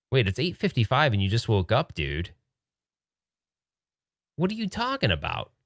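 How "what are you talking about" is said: pitched way up in disbelief; can't believe what he hears; incredulous. Real, not acted. Disbelief at late wake-up.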